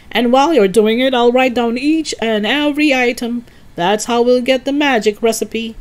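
excited cry. A narrator's reading. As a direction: steamroll the objection with eager instructions. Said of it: "cried Mister Cranky".

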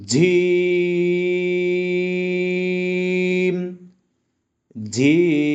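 "জিম" with a firm j and very long vowel; hard-onset "জিম", stretched right out to the m; hard Arabic jim. Shown as a demonstration. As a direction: chant "জিম" slowly on one steady note. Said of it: "এই জিমের উচ্চারণটা শক্ত হবে".

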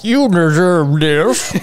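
slow, put-on dog voice; droopy basset hound. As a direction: slowly voice a droopy, reproachful hound. Voicing the staring basset hound.

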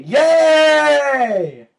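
very high, loud shouted cheer; squeal of triumph. Real, not acted. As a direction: burst out in a squealing yay.

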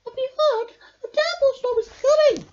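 high, loud and fast squeaky warning; breathless mouse alarm. A narrator's reading. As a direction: rush the news out like a frightened mouse. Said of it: "cried Mouse".

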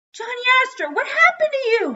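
very high, loud lament; anguished cry. A narrator's reading. Dad mourning his broken Johnny Astro, high loud wailing. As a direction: cry out to a lost treasure, pitch sky-high. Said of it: "he cried".

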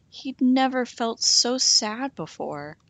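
plain reading voice

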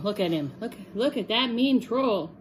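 ordinary delivery

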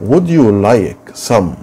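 very slow, deliberate drill; slow classroom modelling; slow model phrase. Shown as a demonstration. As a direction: say each word slowly for pupils to copy. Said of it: "اقول".

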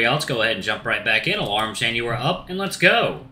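usual speaking voice

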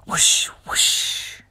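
soft whispered whoosh sound. Acted. Reading to children, whispering the whoosh. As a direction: whisper it like a fan waving.

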